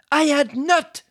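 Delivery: high indignant squeak; offended mouse snapping back; angry mouse. Acted. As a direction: protest sharply as the mouse. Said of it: "cried the mouse angrily".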